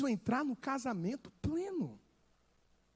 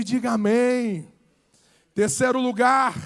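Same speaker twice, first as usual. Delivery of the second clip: normal talking voice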